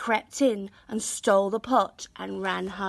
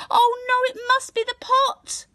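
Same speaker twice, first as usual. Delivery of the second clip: much higher, louder dismay; alarmed realisation; 5 dB louder than usual. A narrator's reading. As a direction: gasp as it dawns. Hannah sees porridge.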